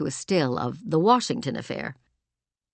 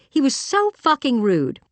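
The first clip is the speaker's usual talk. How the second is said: higher-pitched, mildly heated outburst; indignant; 9 semitones higher, 4 dB louder than usual. Acted. Julia to Roberto after Doug snubs him, indignant. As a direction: protest the insult, voice jumping up in pitch.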